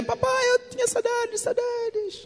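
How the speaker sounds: put-on very high, soft childish voice; little daughter voice. Interpreter echoing the daughter missing her father, high childlike. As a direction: coo it like a small girl.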